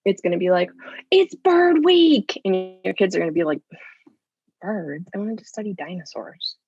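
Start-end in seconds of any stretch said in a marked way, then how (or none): 1.1-2.4 high, loud and drawn out, like a big reveal; over-eager announcement. Retold or imitated. Parent announces themed week, over-eager. 4.6-6.6 put-on disappointed kid. Kid unimpressed by bird week.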